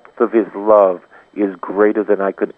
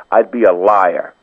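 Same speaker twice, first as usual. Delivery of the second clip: ordinary talk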